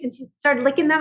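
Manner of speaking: ordinary talk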